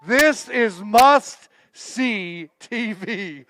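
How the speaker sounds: slow, drawn-out hype; hyped announcer. Acted. Host teasing the live stream about tomorrow's guest, drawn-out hype. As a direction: stretch it out like a big announcement.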